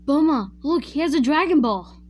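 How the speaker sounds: put-on high, loud, excited little kid; high-pitched childlike Goku voice; kid impression. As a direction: pipe up like an eager child showing off a find. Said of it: "That's my best kid, uh, kid, uh, impression".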